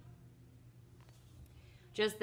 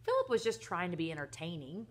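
ordinary delivery

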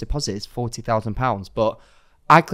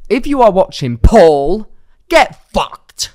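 loud, raised-pitch telling-off; angry callout; 7 semitones higher, 11 dB louder, much slower than usual. Real, not acted. Podcaster addressing her would-be scammer, loud angry callout. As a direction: hurl it at him, much louder on the name.

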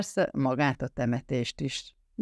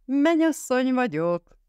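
high, loud joyful cry; excited shout; 10 semitones higher, 3 dB louder than usual. A narrator's reading. Linda announcing her engagement to friends, excited shout. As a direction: burst out with the big news. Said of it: "kiáltotta Linda".

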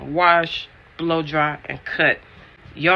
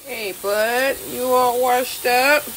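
doting pet talk; 8 semitones higher, much slower than usual. Real, not acted. Owner to a freshly washed dog, doting high voice. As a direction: coo questions up high to your dog. Talking to her dog after bath.

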